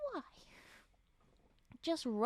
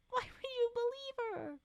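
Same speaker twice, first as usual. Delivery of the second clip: higher incredulous; 11 semitones higher than usual. Real, not acted. Reader repeats higher.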